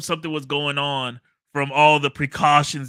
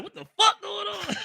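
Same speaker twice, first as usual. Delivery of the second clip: high-pitched, softer imitated alarm; mock startled reaction; 5 dB quieter than usual. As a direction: act out a startled "what on earth", high and held back. Acts own startled reaction, house rattling.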